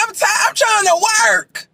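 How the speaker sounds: high-pitched mock exasperated man; imitating Jimmy. Mimicking Jimmy begging off because of work, mock exasperation. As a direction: plead it in a high, exaggerated voice. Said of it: "Jimmy like".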